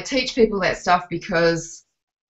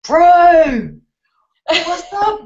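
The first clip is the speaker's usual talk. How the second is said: loud, high, drawn-out call; high-pitched mock hail to a mate; jokey greeting; 10 semitones higher, 7 dB louder, much slower than usual. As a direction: holler it playfully, stretching the vowels. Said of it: "I'm always like".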